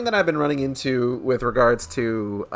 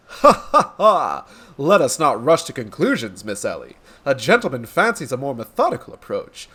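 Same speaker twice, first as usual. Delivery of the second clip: grandiose detective declaiming to an audience; theatrical, florid gentleman sleuth; pompous inspector. Acted. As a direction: proclaim it like a self-important hero. Inspector reassures reporter.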